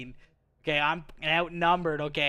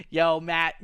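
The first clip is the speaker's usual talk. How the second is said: normal talking voice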